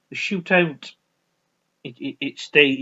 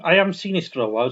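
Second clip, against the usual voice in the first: ordinary talk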